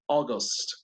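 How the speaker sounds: August with the vowels fused to O. Shown as a demonstration. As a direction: say August as "o-gost". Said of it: "piénsenle ahora más bien con O".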